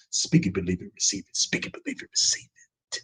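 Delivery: near-whispered low chant; quiet face-to-face vow. Acted. Fighter sealing a warning to his rival, hushed low chant. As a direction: breathe it out very softly, fading into a whisper. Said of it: "That's what I would have told him".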